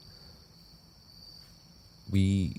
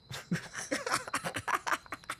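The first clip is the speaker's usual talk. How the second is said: broken laugh; 11 dB quieter than usual. Acted. Alone, trapped, broken down.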